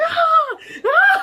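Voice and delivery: loud, very high shrieks; re-enacted screaming. Replaying screams at her boss, loud shrieking. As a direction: shriek twice, hammed up. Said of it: "So I'm screaming".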